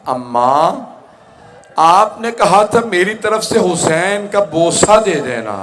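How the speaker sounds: high, loud cry of imitated grief; anguished appeal. Grieving daughter calling to her mother, high loud lament. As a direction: lift it into a sustained lamenting call.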